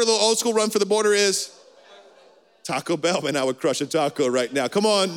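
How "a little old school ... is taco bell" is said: ordinary talk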